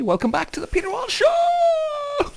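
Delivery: big high excited shout; shouted show intro. Real, not acted. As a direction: belt out the welcome like a host.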